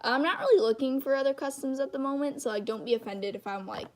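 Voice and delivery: ordinary talk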